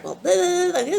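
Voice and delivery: high, whiny mock kid voice. Mock kids pleading their side to the adult. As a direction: squeal it like two kids talking over each other. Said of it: "they both start".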